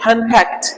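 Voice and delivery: हैंड फैक्ट with only a light t at the end; touch the last t of हैंड फैक्ट lightly; light final T. Shown as a demonstration. Teacher correcting how it is read, हैंड फैक्ट with light final T. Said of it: "हल्का ट लेके जाएंगे".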